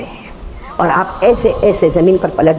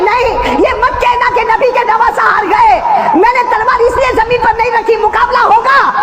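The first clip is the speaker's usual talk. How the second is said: preaching at full force. Acted. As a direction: cry it out to the crowd.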